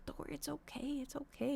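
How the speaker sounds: soft, hushed reassurance; calm the frightened cat down gently; quietly soothing her growling cat. Retold or imitated. Soothing hissing cat at vet.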